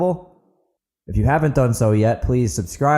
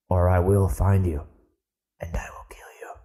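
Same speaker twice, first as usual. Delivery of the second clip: mock threat sinking to a low unvoiced whisper; playfully sinister, dropping into a whisper; mock menace; 4 semitones lower, 5 dB quieter than usual. Joking threat to leave reviews.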